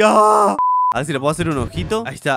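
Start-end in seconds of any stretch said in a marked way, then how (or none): 0.0-0.6 long held "aah", raised and loud; mock scream. Player imitating the bitten Earth screaming, mock scream. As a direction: playfully scream as the planet, one sustained note. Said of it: "Está como gritando la tierra así".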